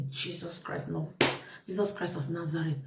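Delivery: low, very soft shocked exclamation; hushed disbelief. Retold or imitated. Storyteller reliving shock at being accused, hushed disbelief. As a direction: drop to a hushed, retold gasp of disbelief.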